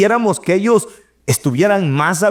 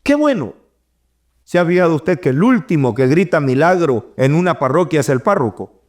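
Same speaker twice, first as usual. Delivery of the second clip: normal talking voice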